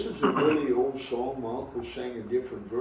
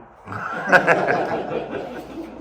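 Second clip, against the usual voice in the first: big laugh; 2 semitones higher, 5 dB louder than usual. Real, not acted. Laughing at his own punchline.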